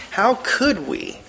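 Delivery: COULD pitched high, slow, then falling; lightly acted disbelief, leaning hard on COULD; stress on COULD. Shown as a demonstration. Teacher voicing Paul's verse as betrayed reproach, stressed COULD. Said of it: "That's the sense that's in the".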